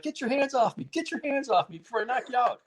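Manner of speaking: high-pitched imitation of a furious fighter; mock screaming. Fighter warning the referee who grabbed him, mock screaming. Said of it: "And he's screaming at the guy".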